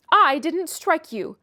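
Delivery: ordinary delivery, nothing marked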